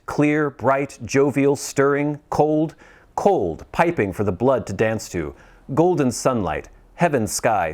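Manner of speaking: usual speaking voice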